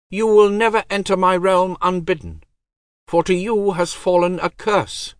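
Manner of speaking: high, cold divine voice; goddess voice. Acted. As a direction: speak as an aloof goddess.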